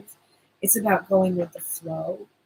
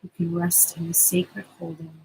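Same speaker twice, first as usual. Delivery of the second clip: guided meditation. Acted. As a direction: soothe them into calm, unhurried. Leads the promised meditation.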